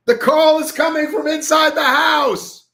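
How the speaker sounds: high, drawn-out panicked yell; hammed-up shriek of alarm; mock horror-movie scream. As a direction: scream the warning like a slasher film. Horror-film line shouted as a joke.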